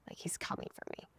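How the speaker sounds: full whisper, no voice at all; private thought. Retold or imitated. Woman in the car thinking of him, whispered. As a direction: whisper it secretly to yourself. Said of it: "And in my mind".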